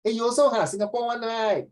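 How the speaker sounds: high excited Singlish; Singaporean accent. Retold or imitated. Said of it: "you come from Singapore one".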